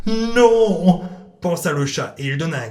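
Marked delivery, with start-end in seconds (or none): 0.1-1.0 high loud refusing cat; defiant cat. Acted. Cat Salem refusing the vet, loud and high. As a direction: refuse flatly like a stubborn cat.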